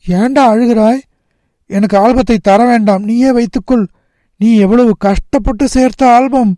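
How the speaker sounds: put-on high, concerned boy's voice; protesting boy. A narrator's reading. Nagarajan refuses his crying friend's album, high and earnest. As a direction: insist earnestly, pitched right up.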